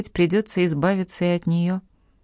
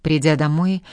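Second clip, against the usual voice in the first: plain reading voice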